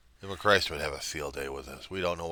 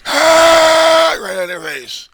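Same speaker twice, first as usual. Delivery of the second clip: high, loud screaming yell; yelling in the face; 18 dB louder, much slower than usual. Acted. Bully screaming in the Reverend's face, loud high yell. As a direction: scream right in their face. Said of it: "I'm gonna be yelling and screaming".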